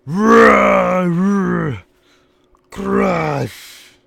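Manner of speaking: loud, high brutish roar; caveman growl. Retold or imitated. Brian Adams roaring at Bischoff, loud brute shout. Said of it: "Brian Adams just says".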